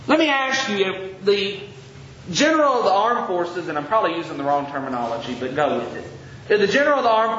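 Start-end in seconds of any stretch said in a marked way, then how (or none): none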